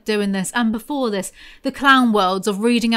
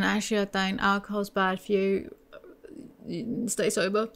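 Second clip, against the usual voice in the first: ordinary talk, nothing marked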